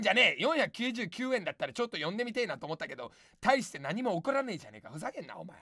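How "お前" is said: normal talking voice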